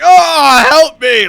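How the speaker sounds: high, loud wail for rescue; hammed-up distressed shout; mock cry for help. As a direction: yell as if falling through the floor. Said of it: "you're like".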